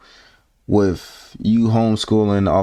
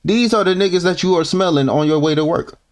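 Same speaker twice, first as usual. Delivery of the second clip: ordinary talk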